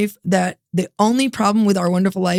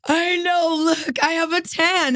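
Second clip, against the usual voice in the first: higher, drawn-out mock boast; husband's proud retort; 9 semitones higher, slower than usual. Husband showing wife his farmer tan, drawn-out boast. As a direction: imitate him showing off, pitched up and slow. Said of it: "And he goes".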